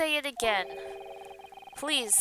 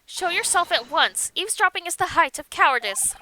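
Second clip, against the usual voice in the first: louder, higher stern command; commanding challenge; 4 semitones higher, 5 dB louder than usual. Acted. Susato ordering the eavesdropper to show himself, stern and loud. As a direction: call out the hidden eavesdropper.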